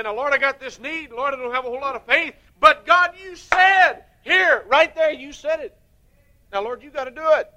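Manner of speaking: loud, high, insistent pleading with God; bold pleading prayer. Pretend. Believer boldly holding God to his word, loud and high. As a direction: claim the promise out loud, pressing God hard.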